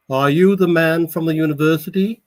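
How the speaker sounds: high, loud Brooklyn woman putting on airs; a Brooklyn lady faking poshness; poorly hidden Brooklyn accent. A narrator's reading. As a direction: Brooklyn accent she tries to mask. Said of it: "in a poorly concealed Brooklyn accent".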